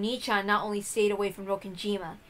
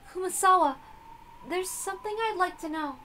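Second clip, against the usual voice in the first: put-on timid young girl; 9 semitones higher than usual. Young Beato asks her servant a question, higher and shy. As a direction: ask like a shy girl seeking answers.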